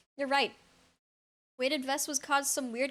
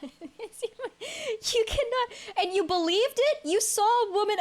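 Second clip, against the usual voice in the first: slow words dragged out through laughter; giggling in disbelief at chat; laughing disbelief; 8 semitones higher, 4 dB louder, slower than usual. Real, not acted. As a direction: laugh while you protest. Laughing at chat's gullibility.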